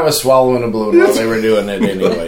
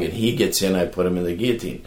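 ordinary delivery

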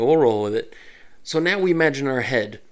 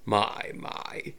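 villainous whisper; 6 dB quieter than usual. Acted. Villain taunts.

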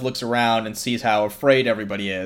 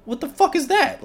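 indignant. Retold or imitated. Homeless guy quoted.

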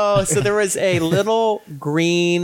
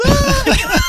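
high, louder mock scream of fright; a friend's leg grabbed from under the bed, yelp; startled scream; 6 dB louder than usual. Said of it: "and they would".

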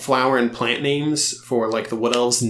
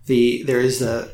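ordinary talk, nothing marked

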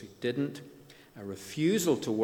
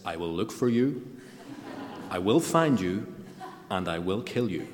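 put-on menacing threat in a Ballymena-American accent; Ballymena-tinged American accent, deliberate and threatening; Liam Neeson; 4 dB louder than usual. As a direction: put on a loud Northern Irish American accent. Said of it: "in a Ballymena American accent like that".

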